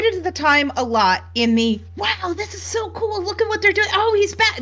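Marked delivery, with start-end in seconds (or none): 1.9-4.6 high, gushing excitement with a laugh; excited fan. Retold or imitated. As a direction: squeal like a thrilled fan. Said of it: "That kind of enthusiasm".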